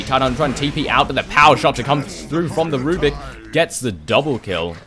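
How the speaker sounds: building live fight call; excited play-by-play. Real, not acted.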